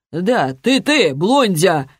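put-on slow, drawn-out drunken taunt; drunk thug. A narrator's reading. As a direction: stretch it out like a tipsy lout. Drunk thug taunting blond stranger. (пьяная тягучая издёвка)

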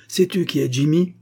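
high, loud angry question; heated challenge; furious. A narrator's reading. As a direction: demand it furiously.

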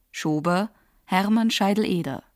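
ordinary talk, nothing marked

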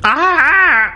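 high piercing scream of pain; agonized scream. Acted. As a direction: shriek as if torn apart. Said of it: "kêu gào thảm thiết đầy thống khổ".

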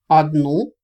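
loud, surprised question. A narrator's reading. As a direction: ask it in open surprise. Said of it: "удивился вампир".

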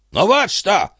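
high, firm interjection; indignant cut-in. Acted. Abbot cutting in to defend himself.

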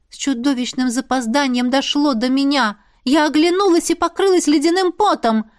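ordinary delivery

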